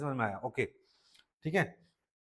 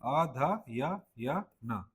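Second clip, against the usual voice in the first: अ ध य य न spelled out slowly, each sound apart; slow teacher spelling of अ ध य य न; syllable by syllable; 4 dB quieter, much slower than usual. Shown as a demonstration. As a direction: sound out अ ध य य न one syllable at a time. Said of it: "यहाँ का अध्ययन होता है ना".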